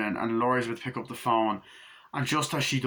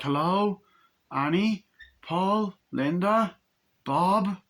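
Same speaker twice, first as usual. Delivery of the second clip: higher, questioning calls; tentative retold name-calling, pitched up; 7 semitones higher, slower than usual. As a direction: imitate searching a dark house by name. Character calls out in dark house.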